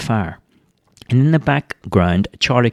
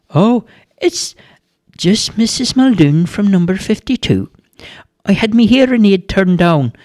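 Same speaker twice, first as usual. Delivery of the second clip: a hard-of-hearing granny calling back; deaf old woman; 10 semitones higher than usual. A narrator's reading. Missus Muldoon asks Charlie to speak up, high old-lady voice.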